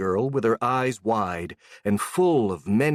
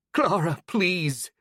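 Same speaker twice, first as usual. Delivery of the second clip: broken voice; 8 semitones higher, slower than usual. Acted. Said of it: "the old woman said with a broken voice".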